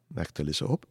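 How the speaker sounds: ordinary delivery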